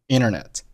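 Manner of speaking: internet said as "innernet"; dropped t. Shown as a demonstration. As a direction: leave out the first t of internet. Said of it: "通常可以不把t爆出来".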